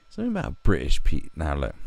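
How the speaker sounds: normal talking voice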